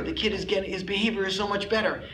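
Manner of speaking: ordinary talk, nothing marked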